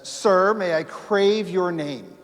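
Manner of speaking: a mock-gallant British officer asking a name; gentlemanly manner. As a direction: ask it like a polite officer. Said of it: "in a very gentlemanly manner".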